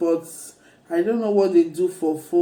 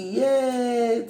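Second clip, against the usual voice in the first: excited yay; 5 semitones higher than usual. Real, not acted. Cheering at husband's gift.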